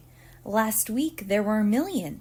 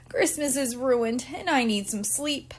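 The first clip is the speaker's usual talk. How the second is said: mild weepy mom; 4 semitones higher than usual. A narrator's reading. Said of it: "Mom started to weep".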